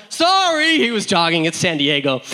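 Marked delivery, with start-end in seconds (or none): 0.1-0.8 high, loud called-out sorry; singsong shouted apology, pitched way up; calling apology. Retold or imitated. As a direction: imitate a passing jogger calling out. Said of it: "their owner was like".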